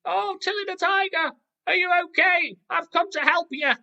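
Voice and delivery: very high, loud squeaky little creature; Lily the ladybird. Acted. Ladybird coming to help her tiger friend, high squeaky voice. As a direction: pipe up eagerly like a tiny bug. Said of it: "Lily the ladybird's like".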